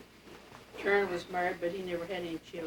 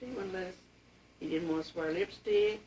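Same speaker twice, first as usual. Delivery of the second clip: normal talking voice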